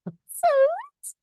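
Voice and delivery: very high, sweet princess voice; squeaky high cartoon princess; Princess Peach voice. Shown as a demonstration. Guest shows off voice acting as Peach, very high. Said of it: "I could go to, like, Peach".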